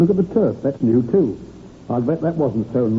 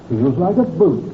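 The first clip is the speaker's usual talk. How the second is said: ordinary delivery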